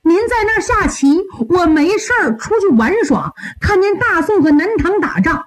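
high-pitched, distressed pleading; 徒弟苦苦哀求. Acted. Disciple begs not to be dismissed. (小徒弟慌忙高声哀求师傅)

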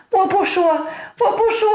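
high-pitched wailing wife voice; storyteller's acted weepy wife; tearful wife. A narrator's reading. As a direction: greet your husband in a high, fretful lament. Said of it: "正在屋中掉眼泪呢".

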